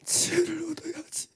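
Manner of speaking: soft whispered exhortation; whispered plea for wisdom. Real, not acted. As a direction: breathe it out, barely voiced. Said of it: "whispered then repeated".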